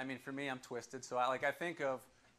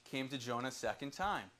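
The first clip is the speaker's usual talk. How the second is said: lower, softer solemn reading; scripture reading; 5 semitones lower, 3 dB quieter than usual. Acted. Preacher reading Jonah chapter three to congregation, solemn. Reads Bible chapter aloud.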